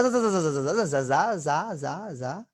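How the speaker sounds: rapid buzzing "za" chain on যা, easing off; light z sound. Shown as a demonstration. Teacher shows like this, a run of buzzed z syllables. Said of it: "like this".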